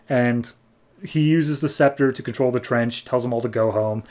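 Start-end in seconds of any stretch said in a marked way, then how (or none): none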